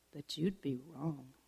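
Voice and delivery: usual speaking voice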